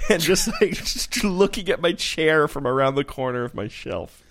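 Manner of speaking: can barely get it out for laughing; laughing while talking. Real, not acted. Picturing hiding from his new chair, laughing. Laughing through silly scenario.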